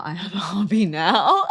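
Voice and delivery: breaking into loud laughter; laughing. Real, not acted. Joking about a new hobby, laughing. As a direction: let the words tip over into a laugh.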